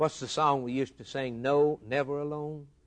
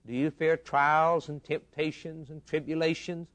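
ordinary delivery

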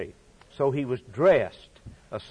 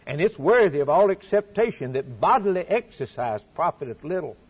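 ordinary talk

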